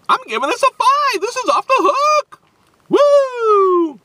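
high, loud, thrilled squeal; excited. Real, not acted. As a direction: gush with delight. Rating it five, thrilled.

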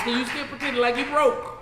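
ordinary talk, nothing marked

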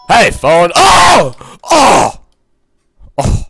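loud high startled cries; sudden loud yelps; startled shout. Real, not acted. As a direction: yell out in surprise. Phone interrupts the game.

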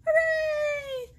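high, loud mock cheer; hammed-up storybook hooray. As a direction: cheer like your side just won. Cheering side wins.

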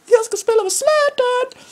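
high singing joy; ecstatic cry. Real, not acted. Called up with Zlatan.

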